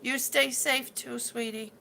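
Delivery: ordinary talk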